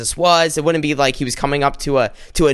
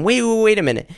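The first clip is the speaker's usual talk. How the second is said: higher, alarmed protest; the Pharisee protests to Jesus, higher and alarmed; freaking out; 6 semitones higher than usual. Acted. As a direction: cry out in outrage as a shocked Pharisee. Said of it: "the Pharisee starts freaking out".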